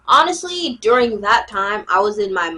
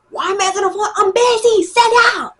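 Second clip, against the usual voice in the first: higher, louder annoyed snap; annoyed yell; 10 semitones higher, 5 dB louder, faster than usual. Retold or imitated. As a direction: shut him down with a loud retort. Yelling back at a clingy boy.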